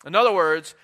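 ordinary talk

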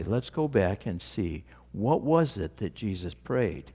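ordinary talk

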